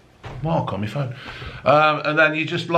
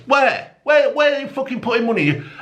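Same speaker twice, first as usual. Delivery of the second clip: indignant high outburst; 13 semitones higher than usual. Real, not acted. Angry rant at government.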